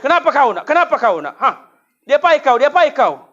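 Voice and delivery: high, loud scolding mother; a mother storming at her crying child; angry mother. Retold or imitated. (ibu marah, suara tinggi dan keras)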